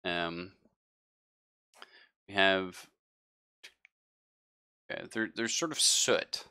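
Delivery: ordinary talk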